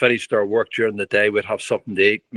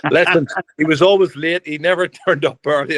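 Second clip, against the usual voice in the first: laughing; 6 semitones higher than usual. Real, not acted. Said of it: "George needed makeup?".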